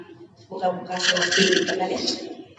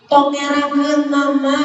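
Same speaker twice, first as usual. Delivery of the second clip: loud, higher mock scold of a mother; 5 semitones higher, 10 dB louder than usual. Mother warning her child not to shame her, mock scold. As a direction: nag like a strict mother.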